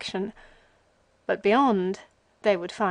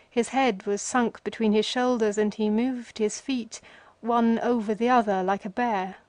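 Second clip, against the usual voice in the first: usual speaking voice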